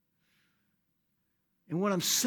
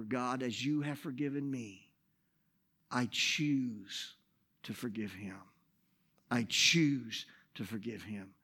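softer and lower, slow resolve; quiet prayer; 4 semitones lower, 4 dB quieter than usual. Retold or imitated. Pastor praying to forgive the man, soft and low. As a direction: pray it softly, choosing each word.

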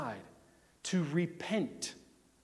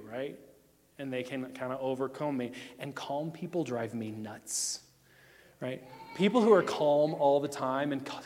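usual speaking voice

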